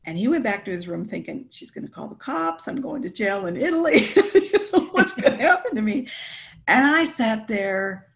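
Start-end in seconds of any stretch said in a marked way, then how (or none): 1.5-6.1 high-pitched worry breaking into laughter; laughing, high retold fretting; mock panic. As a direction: imitate his panic while laughing. Acts out his panicked thoughts, laughing.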